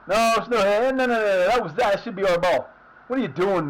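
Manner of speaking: loud, outraged no no no; angry protest. Real, not acted. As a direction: yell at the referee in disbelief. Protesting referee call.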